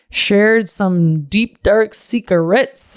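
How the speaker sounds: each word slowed and leaned on; slow dramatic reveal. Real, not acted. To listeners about her trip, slow teasing. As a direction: drag out the juicy secret. Confessing dark secrets, drawn out.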